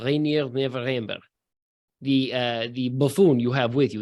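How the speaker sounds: a hint of Russian accent, unhurried; understated Russian accent, deliberate =131; Russian mercenary voice. GM voicing the mercenary.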